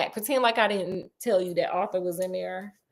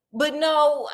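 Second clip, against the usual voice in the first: usual speaking voice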